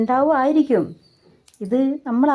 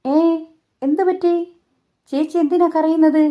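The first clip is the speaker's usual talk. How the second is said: high, louder startled question; uncle surprised; 6 semitones higher, 3 dB louder than usual. Retold or imitated. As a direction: ask in alarm why she is crying. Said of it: "മാമൻ ചോദിച്ചു".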